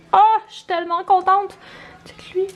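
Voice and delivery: high, delighted squeal of joy; excited. Real, not acted. Thrilled by fresh cookies.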